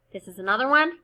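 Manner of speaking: usual speaking voice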